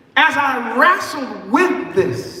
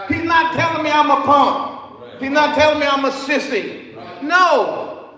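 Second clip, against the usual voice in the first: ordinary talk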